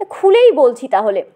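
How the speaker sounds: normal talking voice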